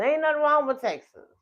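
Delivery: high, loud and insistent; emphatic disclaimer. Real, not acted. Speaker hastily assuring viewers Texas is fine, high emphatic disclaimer. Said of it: "I ain't mean to say it like that".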